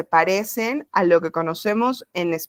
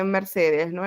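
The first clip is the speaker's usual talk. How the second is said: normal talking voice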